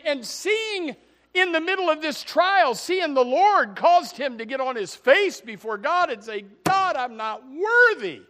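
loud, high, slowed preaching cry; full-force preaching. Real, not acted. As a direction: proclaim it with sustained force. Climactic preaching of Isaiah's vision.

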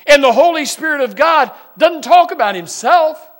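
loud, rising preacher's cry; full-force preaching. Acted. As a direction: preach it out to the back row.

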